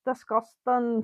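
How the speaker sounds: put-on soft, dubious Scottish accent with laughter. Repeating the bad Scottish accent on request for the co-host. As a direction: badly attempt Scottish, softly amused. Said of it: "Do it again".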